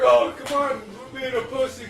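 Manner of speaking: loud, high jeering shout; mocking taunt. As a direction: friends jeering from the shore, shouting. Mocking friends on shore.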